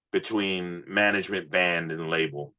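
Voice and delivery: ordinary talk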